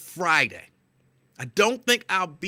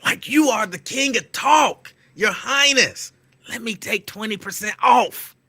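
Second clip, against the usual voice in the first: loud, slow sarcastic proclamation; mock grandeur; 8 semitones higher, 6 dB louder, slower than usual. Mocking the clerk who should have given him a deal, loud and slow. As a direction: ham it up like royalty. Mocking expected royal treatment.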